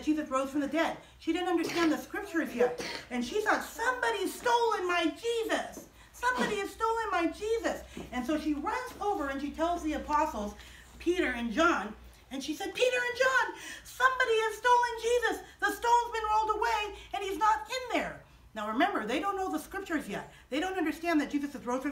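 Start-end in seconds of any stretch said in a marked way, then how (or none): 3.6-7.8 high, acted cry of distress. Mary believes body was stolen. 12.7-18.2 high, urgent acted alarm. Mary alarms the apostles.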